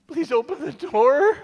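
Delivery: high pleading; high frantic begging, fading; desperate begging. Acted. Begging at the door.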